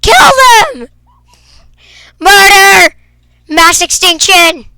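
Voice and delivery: high, loud mock villain yell; mowing down zombies, cheer in mock menace; gleeful battle cry. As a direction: whoop like a cartoon conqueror.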